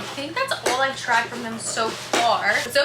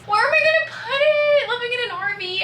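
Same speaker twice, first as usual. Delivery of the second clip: high, louder giddy question; excited squeal; 11 semitones higher, 3 dB louder than usual. Real, not acted. Vlogger gushes over the portrait, high excited squeal. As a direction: squeak it out in happy panic.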